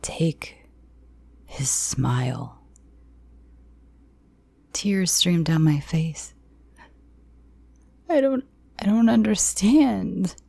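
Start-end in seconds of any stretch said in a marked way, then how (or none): 0.0-2.6 quiet cold order. Acted. Captor's quiet slow command. 8.1-10.4 high, tearful stammered plea; crying out in confusion; sobbing plea. Acted. Captive sobbing at the captor in confusion.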